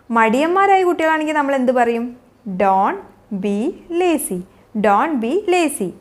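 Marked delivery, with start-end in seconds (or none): 2.5-4.4 slow and low, words separated. Shown as a demonstration. Teacher showing learners what to tell lazy children, word by word. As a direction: pause after "Don't" and after "be". Teacher models phrase slowly, then joined.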